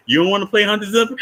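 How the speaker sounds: quick high teasing, rising at the end; fast, high mocking question. Counselor pressing Bernard, fast and high. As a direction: rattle it off like a pushy counselor.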